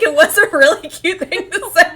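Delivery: high, loud delighted insistence; loud and thrilled, fully sincere; gushing agreement. Real, not acted. Own emphatic agreement with the quote.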